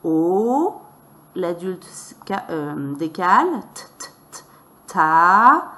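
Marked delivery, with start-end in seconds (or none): 0.0-0.8 "o" held long and rising; drawn-out, sustained "o"; sounding out. Shown as a demonstration. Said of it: "et lit". 3.7-5.7 beginning reader's "t, t, t, ta"; sounding out. Shown as a demonstration. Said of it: "et lit".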